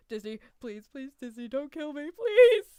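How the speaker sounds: playful begging that climbs to a loud high please; mock plea building to a yelp; mock pleading. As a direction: beg for your life, hammed-up.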